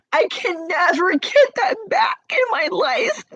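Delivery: high-pitched real sobbing voice; anguished, tearful wail of words; crying. Real, not acted. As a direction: cry it out, pitch climbing high. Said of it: "I'm here to say it's okay to cry".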